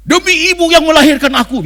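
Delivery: loud, high hammed-up vow of a film hero; Bollywood hero vow. Preacher mimicking Indian film hero vowing revenge. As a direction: declaim it like an Indian movie hero swearing revenge. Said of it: "lihat nggak mau ngomongnya".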